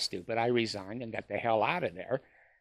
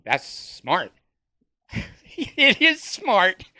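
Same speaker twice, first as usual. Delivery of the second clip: slow, sarcastic smart, the second high with a laugh; sarcastic praise; 7 semitones higher, 6 dB louder, much slower than usual. Acted. To viewers about church investing, slow sarcastic praise.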